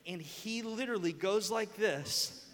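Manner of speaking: usual speaking voice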